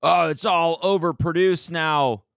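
slow, high mock whine of a critic; mocking commenter. Mocking online commenters, slow high mock whine. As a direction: lightly ham up a snooty commenter. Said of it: "comments from people being like".

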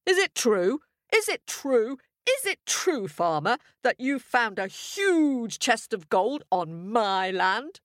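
high, insistent royal demand; hammed-up outraged monarch; bellowing king. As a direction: bellow it as a king, building then slowing. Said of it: "he bellowed".

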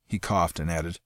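plain reading voice

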